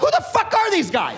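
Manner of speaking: lightly hammed-up what on earth; baffled disbelief. Comic watching strangers on a plane, mock-incredulous squeak. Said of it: "I was like".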